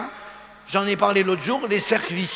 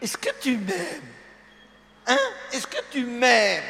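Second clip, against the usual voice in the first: wife pleading; 3 semitones higher than usual. Retold or imitated. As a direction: drag it out like a wife fishing for love. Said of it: "Quand elle dit".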